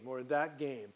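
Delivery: usual speaking voice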